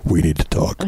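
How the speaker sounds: low, heavy "We need to talk." with the stress on "We"; ominous we need to talk. Shown as a demonstration. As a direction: drop the voice and make it sound like bad news. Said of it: "I think that's better than".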